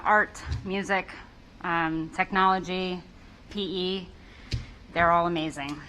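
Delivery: ordinary talk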